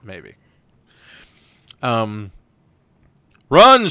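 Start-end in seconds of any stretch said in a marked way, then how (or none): 3.5-3.9 much louder and higher yell; urgent shouted order; shouting. Acted. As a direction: bark the order to flee. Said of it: "shouts Indy".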